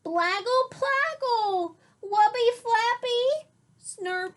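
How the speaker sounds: put-on high, loud baby gibberish; slowing toddler gibberish, pleading; toddler babble.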